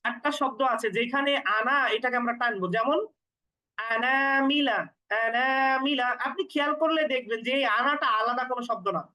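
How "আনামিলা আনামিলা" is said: আনামিলা chanted very slowly with the long a drawn out; stretched recitation. Shown as a demonstration. Teacher shows students where আনা is stretched, slow drawn-out আনামিলা. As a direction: draw out the আ in আনামিলা. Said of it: "আনামিলা এটা একটা শব্দ".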